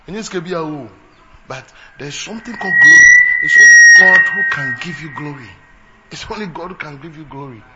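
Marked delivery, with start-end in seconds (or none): 3.4-5.5 loud proclamation. Acted. Preacher repeats line loud then soft. 6.1-7.6 soft repeat. Acted. Preacher repeats line loud then soft.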